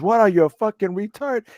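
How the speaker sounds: high, imitated angry customer; mock indignant. Imitating a husband insulting the bagger, indignant.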